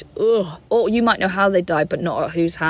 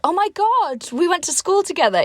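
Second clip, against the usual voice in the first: much higher, bright, overdone surprise; fake delighted reunion; 11 semitones higher than usual. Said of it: "So I came over and I was like".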